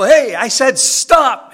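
very loud, high yell; teacher yelling at fleeing students. Retold or imitated. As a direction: bellow it after them. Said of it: "And I go".

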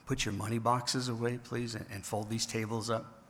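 soft, overly polite gentle asking; mock calm polite request. Preacher mocking a meek Jesus, soft polite voice. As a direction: gently ask the money changers to pack up, meekly polite. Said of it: "He did not in a calm voice say".

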